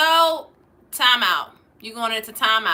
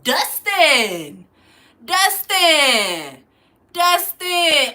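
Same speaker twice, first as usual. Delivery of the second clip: louder drawn-out name call; sing-song calling him out; calling his name; 3 semitones higher, 5 dB louder, much slower than usual. Real, not acted. Calling out Dustin on stream, loud and teasing.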